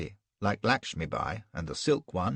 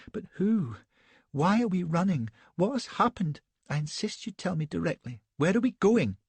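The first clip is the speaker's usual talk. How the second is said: much higher, demanding ladylike voice; Elsbeth's indignant cry; 9 semitones higher than usual. A narrator's reading. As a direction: insist like a spoiled lady. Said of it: "cries she".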